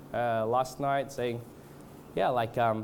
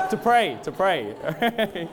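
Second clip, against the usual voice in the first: louder, higher, drawn-out clarification through amusement; laughing, emphatic backpedal; joking self-correction; 6 semitones higher, 5 dB louder, much slower than usual. Real, not acted. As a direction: hastily insist on what you meant, amused. Jokingly corrects himself amid laughter.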